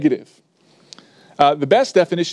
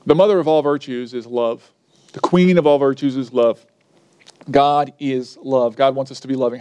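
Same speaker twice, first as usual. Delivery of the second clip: ordinary talk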